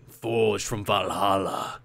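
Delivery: slow, lightly grand epic narrator; a hint of a movie trailer voice; mock epic voice. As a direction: intone it like a saga. Reading a grand Viking claim.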